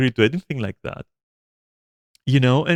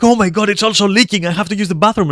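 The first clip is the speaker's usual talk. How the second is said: high, loud imitation of distress; hammed-up frantic complaint; mock panic; 8 semitones higher, 5 dB louder, faster than usual. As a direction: whine it urgently, mimicking someone in a fluster.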